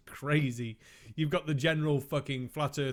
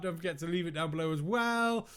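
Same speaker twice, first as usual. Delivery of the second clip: normal talking voice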